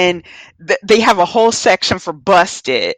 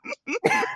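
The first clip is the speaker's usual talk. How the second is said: soft, high breathless giggle; 11 dB quieter than usual. Real, not acted. Friend retelling the punch again, soft high giggle. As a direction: giggle up high, barely voiced. Laughing at the story.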